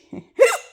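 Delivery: sharp hiccup giggle; squeaky laugh cut by a hiccup. Real, not acted. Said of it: "having the hiccups this whole time".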